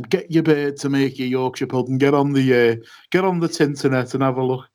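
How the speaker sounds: hammed-up Yorkshire lad teasing the driver; rough Yorkshire accent imitation, quick at the end; mock Yorkshire accent. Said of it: "I can't do a Yorkshire accent".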